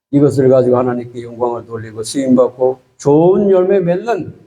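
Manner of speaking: low, earnest prayer voice; closing prayer. Acted. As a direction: pray over the congregation, steady and humble. Pastor leads closing prayer. (목사가 성도를 위해 낮고 간절하게 기도하듯)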